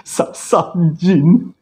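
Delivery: very slow, high, drawn-out dread; eerie stretched realisation. Retold or imitated. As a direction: let the horror sink in word by word. Realises it was a jinn.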